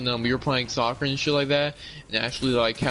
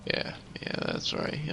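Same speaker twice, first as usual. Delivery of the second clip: mock whisper; 9 dB quieter than usual. Real, not acted. Mocking whispered agreement.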